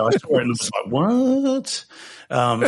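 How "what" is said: high-pitched, drawn-out retold "what"; incredulous. Recalling his reaction to a YouTube comment, incredulous. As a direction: blurt it high in baffled disbelief.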